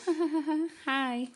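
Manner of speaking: high imitated giggle into a greeting; little girl's voice. Storyteller voicing the unseen girl in the graveyard, little girl's voice. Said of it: "we hear a little girl's voice".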